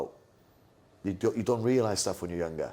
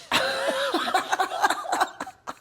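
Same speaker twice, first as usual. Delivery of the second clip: high, wheezy laugh; real laugh; high laughter; 4 dB louder than usual. Real, not acted. Laughing at story.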